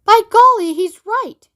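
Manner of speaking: high, loud burst of delight; excited exclamation. Acted. Grandpa Joe agreeing with Wonka, excited and loud. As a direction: blurt it out, thrilled.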